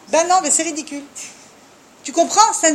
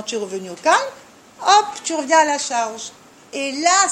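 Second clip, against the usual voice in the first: ordinary delivery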